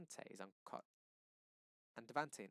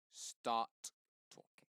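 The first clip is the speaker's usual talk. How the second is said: taunting; 6 dB louder, much slower than usual. A narrator's reading. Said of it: "Daddy taunts".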